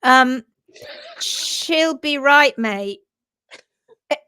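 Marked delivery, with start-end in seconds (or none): 1.2-3.0 slow mock-Australian accent. Shown as a demonstration. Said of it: "in an Australian accent".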